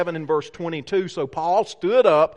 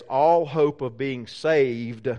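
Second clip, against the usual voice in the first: slow, heavy scripture reading, sinking lower; low, ominous quote with weighty pauses; 5 semitones lower, slower than usual. Acted. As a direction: let the words fall slowly and ominously. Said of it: "with this ominous phrase".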